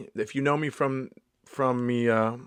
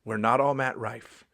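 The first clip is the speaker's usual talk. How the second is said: usual speaking voice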